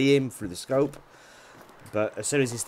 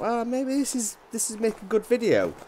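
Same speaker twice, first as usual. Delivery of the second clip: high thought voice; 12 semitones higher than usual. Retold or imitated. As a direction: jump way up into a high, put-on voice. Thinking aloud on spotting reindeer.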